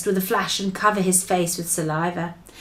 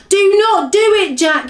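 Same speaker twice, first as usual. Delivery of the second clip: loud, high panicked screech; 10 semitones higher, 8 dB louder than usual. A narrator's reading. Giant begging Jack to stop, screeched. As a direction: screech it as a desperate plea. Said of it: "he screeched".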